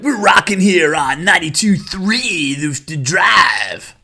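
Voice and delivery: hammed-up station ID yell; cheesy radio DJ. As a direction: belt the station name like a DJ. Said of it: "radio DJ impression".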